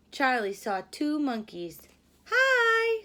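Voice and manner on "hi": high, loud friendly caterpillar greeting; young caterpillar calling out hello; Charlie's cheery hi. Acted. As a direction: greet them brightly as little Charlie. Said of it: "said Charlie".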